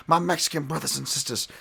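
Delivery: high-pitched impression of a boxing promoter; Don King. Commentator quoting Don King on Mexican toughness, impression. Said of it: "As Don King would say".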